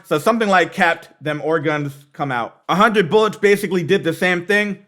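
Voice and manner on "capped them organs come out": "Capped, them organs" said slowly, split into separate words; word broken apart. Shown as a demonstration. Explaining a rapper's wordplay to viewers, words slowly split apart. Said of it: "tried to break it apart even more".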